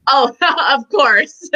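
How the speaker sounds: laughing through the words, slowed; real delighted laugh breaking up the answer. Real, not acted. Delighted question about Silver Fox books.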